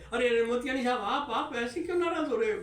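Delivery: a hint of a panicked clerk appeasing; flustered pleading. Clerk Tiwari placating the angry boss, flustered and high.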